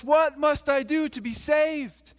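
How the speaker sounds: high, slow, loud plea; loud cry. Acted. Man alone in a field, burdened, crying out loudly. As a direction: cry the question out, drawing it slowly. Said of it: "cried out in a loud voice".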